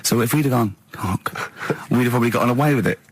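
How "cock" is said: very soft, voiceless whisper. Shown as a demonstration. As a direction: whisper the rude word to get away with it. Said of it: "whisper it very quietly".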